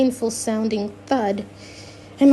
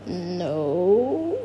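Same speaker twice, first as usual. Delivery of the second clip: a long, lower, guilty "No"; drawn-out guilty no; 5 semitones lower, 4 dB quieter than usual. Acted. Father caught with Miss Teak, drawn-out guilty "No". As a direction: stretch the "No" like someone caught out. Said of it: "drawing out the word and sounding very guilty".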